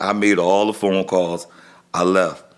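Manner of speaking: usual speaking voice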